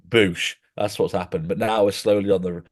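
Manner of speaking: ordinary talk, nothing marked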